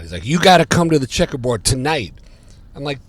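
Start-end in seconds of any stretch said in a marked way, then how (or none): 0.3-2.1 loud, higher, urgent phone plea. Retold or imitated. Drummer urging his friend on the phone, urgent and loud. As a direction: push him hard to come tonight.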